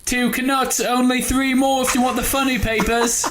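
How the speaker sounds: very high, chirpy street urchin; plucky hopeful orphan. Pretend. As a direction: hawk papers like a cheeky orphan. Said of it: "orphan, like, pickpockets".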